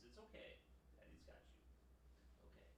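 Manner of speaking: soft reassuring whisper. Real, not acted. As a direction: whisper comfort to a nervous pet. Whispering to the dog.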